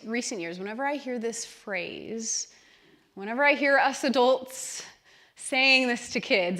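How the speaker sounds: ordinary talk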